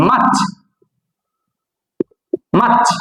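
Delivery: মার্চ as MAACH, silent r; correct pronunciation. Shown as a demonstration. Teacher drilling after asking learners, correct pronunciation. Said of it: "কি হবে".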